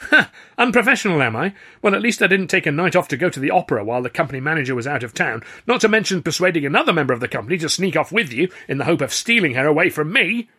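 fast, loud, high counterattack; indignant retort. A narrator's reading. Accused performer throwing the star's night off back at him, indignant.

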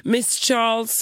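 put-on high apologetic receptionist, Spanish-accented. Receptionist calling back to apologise, Spanish accent. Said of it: "spansk brytning".

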